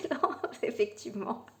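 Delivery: real laugh through the words. Real, not acted. Reader mocking the symmetry remark, laughing. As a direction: agree while cracking up, softly.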